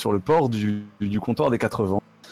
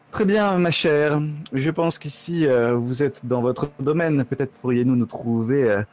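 haughty noble lady. Acted. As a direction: play a prissy aristocrat. Noble lady addresses Estaria.

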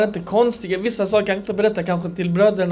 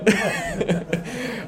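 soft unvoiced chuckle; hushed laugh; breathy laugh; 9 dB quieter than usual. Real, not acted.

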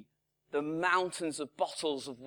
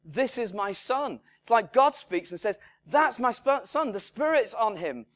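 loud, raised, proclaiming voice; voice of God; 7 semitones higher, 8 dB louder than usual. Acted. God announcing his son at the baptism, loud proclamation. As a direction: declare it like God from heaven. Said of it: "this voice that says".

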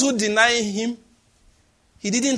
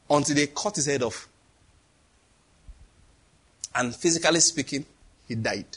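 hushed solemn telling; 6 semitones lower, 6 dB quieter than usual. Acted.

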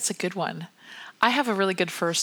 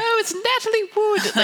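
put-on much higher, louder Russian-accented gushing; thick Eastern European accent; 5 dB louder than usual. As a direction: put on the stylist's heavy Eastern European accent, high and bright. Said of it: "this woman with this ridiculous accent".

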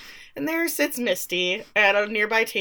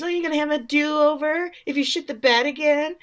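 sneering Travis; 7 semitones higher than usual. Retold or imitated. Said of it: "he says".

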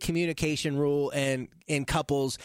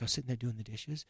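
quiet; 6 semitones lower, 9 dB quieter than usual. Retold or imitated. Mom recounting, quoted.

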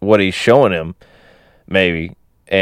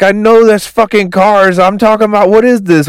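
high-pitched exasperated outburst; mock freak-out; 11 semitones higher, 4 dB louder than usual. Manny, corrected by Hank about the car, freaking out. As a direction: act out someone losing it, pitched way up. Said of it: "freaking out".

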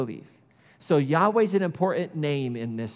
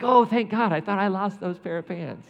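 relieved Jonah; 4 semitones higher, 3 dB louder than usual. Retold or imitated. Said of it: "It's like".

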